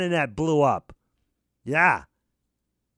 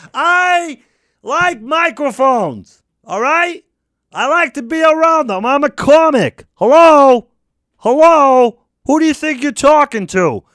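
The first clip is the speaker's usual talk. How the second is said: much higher and louder, indignant; angry rant; 6 semitones higher, 10 dB louder than usual. Acted. A comic indignant at being questioned, yelling. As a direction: bellow at him, offended.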